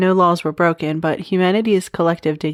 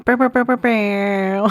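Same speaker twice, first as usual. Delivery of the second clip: lips buzzing like a DJ horn blast; mock airhorn; 3 semitones higher than usual. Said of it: "All of those sound effects".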